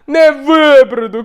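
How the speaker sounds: high, loud, slowed shout; shouting back. Retold or imitated. Friend in the water yells he cannot wade out, loud. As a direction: yell it back in despair. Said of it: "кричить Мулько".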